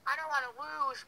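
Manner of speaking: high, loud mimicking whine; exaggerated whiny parroting; mocking imitation. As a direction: throw the words back in a squeaky mock voice. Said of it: "I don't sound like that".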